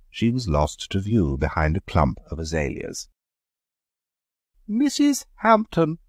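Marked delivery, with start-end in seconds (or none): 4.7-6.0 high, slow solemn opening; solemn address. A narrator's reading. Leonard addressing Mrs Hampton, solemn. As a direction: begin with great gravity. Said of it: "began Leonard with increased solemnity".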